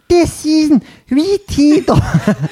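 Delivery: very high, drawn-out squeaky Scouse; laughing caricature Liverpudlian; mock Scouse accent. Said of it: "you've gone Scottish as well".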